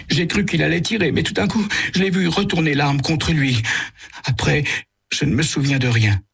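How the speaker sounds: high, frightened, faltering voice; trembling. Acted. Martin telling Sam what happened, trembling high voice. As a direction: shake through the words in fear. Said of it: "Il parle en tremblant".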